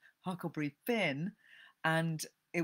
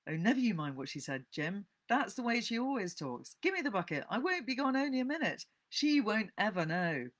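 put-on higher, brisk boy's wheedling; coaxing schoolboy Tom; 7 semitones higher, 5 dB louder than usual. A narrator's reading. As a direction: talk Jim into handing over the bucket. Tom coaxes Jim.

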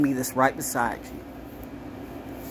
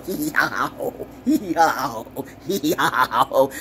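mock donkey bray; 3 semitones lower, much slower than usual. Mocks astrologer as braying donkey.

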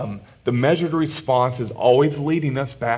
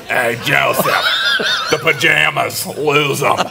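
hammed-up come-on, a bit louder; mock seductress; 2 semitones higher, 3 dB louder than usual. A co-host hamming up Potiphar's wife calling to Joseph. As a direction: play the wife's brash come-on for a laugh.

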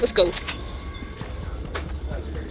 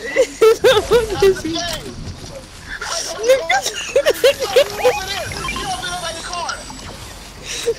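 long high laugh; 13 semitones higher than usual. Real, not acted. Laughing at teammate.

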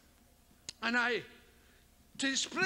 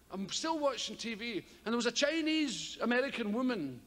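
ordinary delivery

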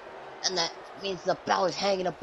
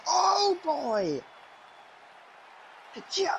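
higher, thrilled exclamation; 9 semitones higher, much slower than usual. Real, not acted. Commentator spotting a new entrant, thrilled. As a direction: cheer the new arrival.

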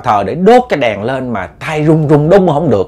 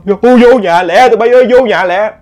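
urgent calling; 8 semitones higher than usual. Retold or imitated. Grandmother calling the girls indoors, high alarmed call. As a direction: wave them in with an alarmed cry. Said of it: "bà kêu".

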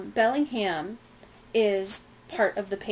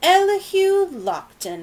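much higher, louder, slow drawn-out call; 10 semitones higher, 9 dB louder than usual. Acted. Bellingham hailing Lockton across the street, calling out loudly and slowly. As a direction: hail him across the dock. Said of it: "Bellingham called".

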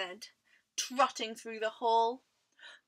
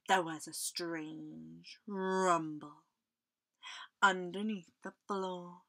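ominous narration; 5 semitones lower than usual. A narrator's reading. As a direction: lower the voice for a mysterious noise. Storyteller builds mystery about noise.

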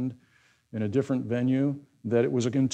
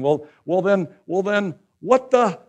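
louder, higher, sputtering exasperation; exasperated; 5 semitones higher, 7 dB louder than usual. Acted. Disciples throwing up their hands at Jesus' teaching, exasperated. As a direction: sputter in disbelief, then blurt out the verdict. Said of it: "see them in their exasperation going".

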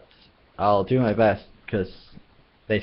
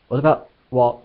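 plain reading voice

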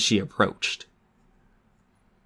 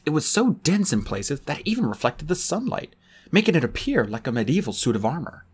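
ordinary delivery

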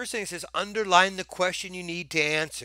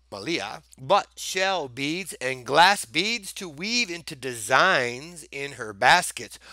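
ordinary talk

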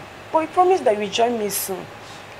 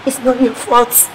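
loud, pained defence; loud distressed protest; emotional outburst; 3 semitones higher, 12 dB louder, slower than usual. Acted. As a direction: cry it out to auntie.

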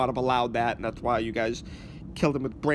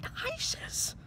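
whispering; 6 dB quieter than usual. Acted. Whispered reveal.

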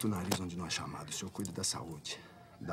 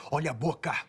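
"Olha a boca" louder and higher, stressing "boca"; sharper warning; 5 semitones higher, 6 dB louder than usual. Acted. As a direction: repeat the warning harder, hitting "boca". Repeats warning more sharply. ("Olha a boca" mais alto e ríspido)

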